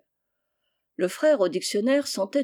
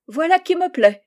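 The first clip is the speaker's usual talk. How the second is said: high, loud, pleased exclamation; delighted approval; 9 semitones higher, 7 dB louder than usual. Acted. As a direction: burst out with royal delight. Princess is delighted with Jean.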